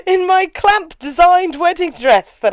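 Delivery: high, slow, gushing and loud; bright magical girl. Pretend. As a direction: show off the dress like a heroine. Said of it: "So I'll be like".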